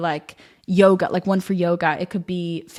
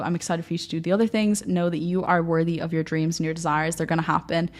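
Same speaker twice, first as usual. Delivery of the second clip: ordinary delivery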